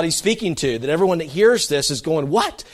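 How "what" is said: shocked what. Retold or imitated. Listeners' shocked reaction voiced.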